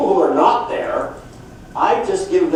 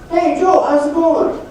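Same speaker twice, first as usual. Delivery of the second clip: high, bright hail to a friend on the street; cheery greeting; 11 semitones higher, 4 dB louder than usual. Retold or imitated. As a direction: call out to Joe, loud and higher. Calling out to Joe on road.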